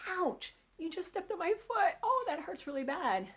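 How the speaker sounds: high-pitched mock ouch from the wife; wife hurt and complaining. Lisa voiced, foot stepped on.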